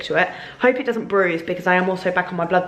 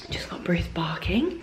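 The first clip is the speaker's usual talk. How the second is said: soft whisper under her breath; whispered aside; 7 dB quieter, slower than usual. Real, not acted. To the camera, about Bruce barking: a whispered aside. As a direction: drop to a quick whisper.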